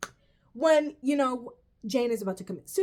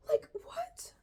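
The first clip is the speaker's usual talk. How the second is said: breathy high whisper of disbelief; incredulous whisper; 9 dB quieter than usual. Real, not acted. Vlogger reacting to her friend's opinion, stunned whisper. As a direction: whisper it like you can't believe it.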